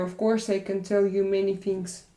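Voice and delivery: normal talking voice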